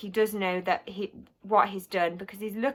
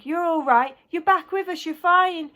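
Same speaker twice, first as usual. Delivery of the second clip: raised, lifted voice, full of relief; eager reassurance; 8 semitones higher, 4 dB louder than usual. A narrator's reading. Nurse to boy just back from coma, eager reassurance. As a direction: reassure brightly on a high pitch.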